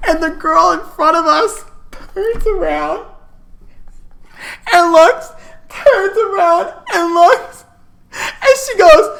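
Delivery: high, squeaky real laughter through the words; laughing while telling. Real, not acted. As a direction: barely get the words out for laughing. Teller cracking up at her story.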